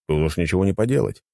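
ordinary delivery, nothing marked

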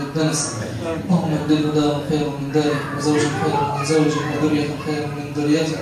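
low, subdued prayer; funeral-style supplication. Real, not acted. Preacher praying for a deceased brother's forgiveness, subdued supplication. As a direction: pray steadily for the dead, voice kept low.

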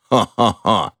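mocking "ho-ho-ho" stage laugh; hammed-up villainous chortle; gloating laugh. A narrator's reading. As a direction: laugh coarsely, syllable by syllable. Count gloats over his mistress.

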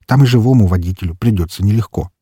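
plain reading voice

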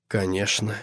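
soft, hushed assent; whispered. A narrator's reading. Devil softly yielding to the demoness, whispered. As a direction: drop the voice well down, rising slightly at the end. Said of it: "прошептал чёрт".

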